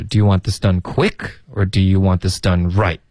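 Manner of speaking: "done right" falls as a settled choice; falling final tune. Shown as a demonstration. Teacher modelling an either-or question, falling ending. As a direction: rise on "quick", then come down on "right". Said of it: "要降下来".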